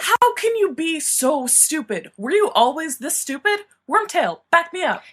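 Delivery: exasperated. A narrator's reading. James berating Sirius.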